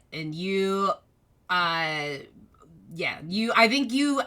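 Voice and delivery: ordinary delivery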